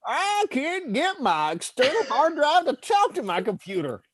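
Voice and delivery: put-on grouse like a cranky old miner; old prospector. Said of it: "I like old prospector voice".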